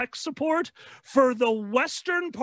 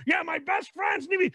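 mock insistent shout; 6 semitones higher than usual. Acted friend insisting.

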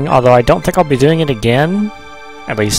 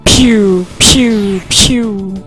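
mock pew sounds; 6 semitones higher, much slower than usual. Imitating laser shots.

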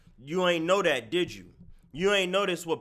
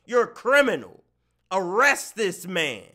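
high-pitched, punchy accusation; mock outrage; 5 semitones higher, 3 dB louder than usual. Streamer denouncing a supposed criminal, hammed-up outrage.

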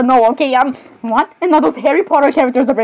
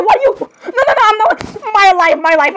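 high, hammed-up panicky protest; flustered panic; 9 semitones higher than usual. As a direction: protest frantically, pitched way up. Acted panic, caught with Inosuke.

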